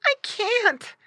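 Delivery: very high piglet voice; high-pitched pig protest; little pig. A narrator's reading. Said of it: "grunted Squinty".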